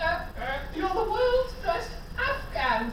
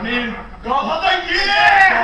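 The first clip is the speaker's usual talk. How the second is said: loud triumphant shout, much louder; excited cheer; 12 dB louder, slower than usual. Real, not acted. Players cheer a goal in the game, shouting. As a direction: celebrate like a scored goal.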